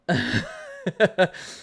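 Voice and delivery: bright burst of giggling; high laugh. Real, not acted. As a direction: laugh at your own joke. Laughs at own joke.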